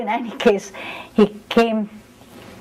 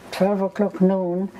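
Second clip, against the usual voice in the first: ordinary talk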